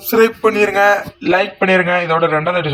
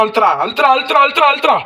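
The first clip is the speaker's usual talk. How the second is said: frantic urging; 8 semitones higher, much faster than usual. Real, not acted. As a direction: yell over and over to push the attack. Urging during a fight.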